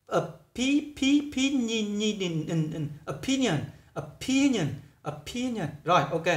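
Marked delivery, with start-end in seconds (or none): 0.1-1.7 opi- and pi- pulled out and drilled high; syllable drill. Shown as a demonstration. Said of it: "thì mình phát âm là opinion". 3.1-5.7 opinion repeated with a clear aspirated p and a falling tune; model pronunciation. Shown as a demonstration. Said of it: "thì mình phát âm là opinion".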